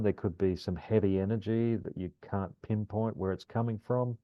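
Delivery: ordinary talk, nothing marked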